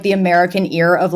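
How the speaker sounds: ordinary talk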